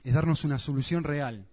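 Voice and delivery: normal talking voice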